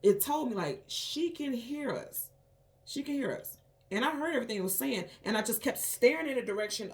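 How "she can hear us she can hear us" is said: high, slow, drawn-out quote; retold eerie voice. Unseen being talking about the dreamer, in a high, slow voice. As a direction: lift the pitch and stretch each word. Repeats the overheard being's words.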